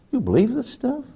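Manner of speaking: high, squeaky mock disbelief; incredulous high voice. Preacher voicing a scoffing pilot, squeaky and incredulous. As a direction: pitch it way up like a scoffing old friend. Friend scoffing at prayer book.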